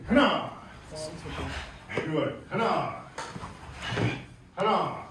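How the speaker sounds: sharp barked count; count shout. Real, not acted. Instructor barking the count to students, loud. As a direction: call the count out to the class. Martial arts count called out.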